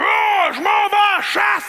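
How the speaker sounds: high, loud he-man boast; macho shout. Retold or imitated. Old-time man heading to hunt, macho shout. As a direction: boast like a caveman. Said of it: "l'homme qui faisait comme".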